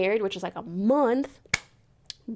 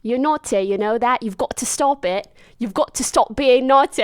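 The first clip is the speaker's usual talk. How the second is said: loud mock British accent; 6 dB louder than usual. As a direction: imitate a teasing Brit, UK accent. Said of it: "so they have an accent".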